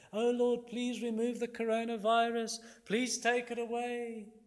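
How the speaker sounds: high mock-whining prayer; insincere pleading. People praying falsely to escape the virus, insincere pleading. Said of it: "We shouldn't be like that".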